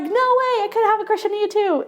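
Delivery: high-pitched, quick pretend excitement; playfully gushing, voice jumping up high; delighted disbelief. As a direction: act thrilled that the crush is mutual. Voices the delighted reply to confession.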